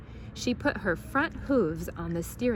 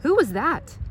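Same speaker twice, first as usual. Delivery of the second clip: surprised; 7 semitones higher, 6 dB louder than usual. A narrator's reading. Mr Watson startled.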